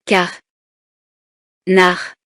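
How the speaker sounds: "Car. Nar." as two isolated syllables, long pause between. Shown as a demonstration. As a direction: break it apart: "Car", silence, "Nar". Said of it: "Je frappe les syllabes". («Car. Nar.» découpé en syllabes séparées par un silence)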